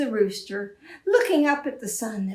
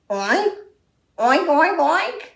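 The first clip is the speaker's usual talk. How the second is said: rising, louder oinks; pig oinks; 6 semitones higher, 6 dB louder, slower than usual. Acted. Storyteller voices the sheep oinking at the farmer. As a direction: oink like a pig. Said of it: "the sheep looked at him and went".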